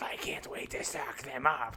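unvoiced creepy insect whisper; bug voice whisper. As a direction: hiss it like a hungry bug. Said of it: "he's doing bug voice".

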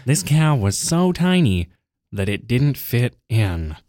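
slow sing-song storybook voice; fairy tale opening. Shown as a demonstration. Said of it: "tell the story with the right inflections".

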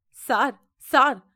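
desperate calling out; wailing cry. A narrator's reading. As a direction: shout for help through the door. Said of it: "கதறிக்கொண்டே".

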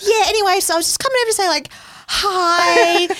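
high, awkward chirpy voice. Retold or imitated. Awkward cover-up greeting.

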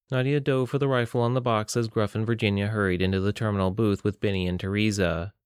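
plain reading voice

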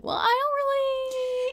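high, slow, drawn-out reluctance; mock hesitant reply. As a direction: stretch it out like a reluctant teen. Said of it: "I'm like".

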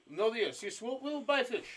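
clumsy mock-Russian delivery; bad Russian accent. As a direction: put on a hammy Russian voice. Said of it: "That was a bad accent".

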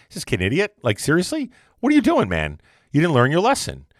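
mock disbelief rising high; incredulous parent scolding; exasperated parent. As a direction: ask it like the kid can't be serious. Parents exasperated at kid again.

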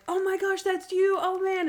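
very high, gushing mock surprise; imitated excited greeting. Old buddy recognizing his friend, high excited greeting. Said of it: "and he's like".

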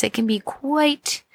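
normal talking voice

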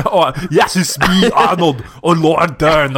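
Arnold voice. Pretend. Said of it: "Thanks, Arn".

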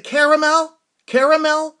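high, loud, held-out questioning; mock calling the word out to someone not understanding; insistent repeated order. Repeating a misunderstood drive-thru order.

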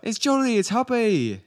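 put-on high, chirpy British accent; Tom Holland. Imitating a British accent for co-host, high chirpy British. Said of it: "Kinda like Tom Holland".

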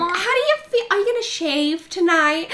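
very high, loud, eager questioning; nosy gushing friend. Retold or imitated. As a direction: pester her with mock excitement. Funny friend's nosy questions acted.

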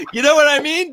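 laughing through the words; cracking up while talking. Real, not acted. Laughs at his own joke.

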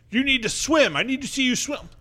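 high-pitched imitation of an angry teacher; hammed-up exasperated gym teacher; mock an annoyed coach, pitch way up; mock pissed-off. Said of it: "he would get pissed".

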